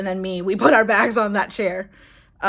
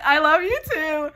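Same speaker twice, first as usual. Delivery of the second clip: retold yell back; 7 semitones higher than usual. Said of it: "he yelled back".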